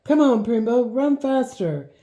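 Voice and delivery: high, loud call to the pony; urging on. Acted. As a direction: spur him on with your voice.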